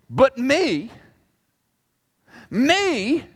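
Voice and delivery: "me" set apart by pauses, pitched high; stress on ME. Acted. God showing his hurt, hard stress on "me". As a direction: punch "me" high, alone after a long pause. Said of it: "word arrangement emphasizes the word me".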